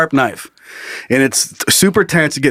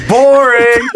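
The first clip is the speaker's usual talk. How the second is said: heckling shout; 7 semitones higher, 4 dB louder than usual. Pretend. Mock heckling.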